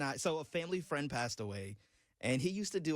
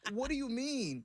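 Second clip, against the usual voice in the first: baffled disbelief; 9 semitones higher than usual. Retold or imitated. Acted stunned reply to dad's whisper.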